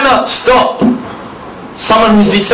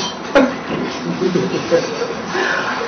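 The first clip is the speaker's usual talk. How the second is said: breathy stifled laugh; 3 semitones lower, 10 dB quieter than usual. Real, not acted. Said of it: "I'm gonna cry".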